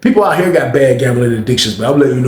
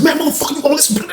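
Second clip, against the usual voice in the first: very high strained mock shouting; frantic high-pitched bullying; retold violent commands. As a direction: bark orders mid-fight, high. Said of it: "All you heard was".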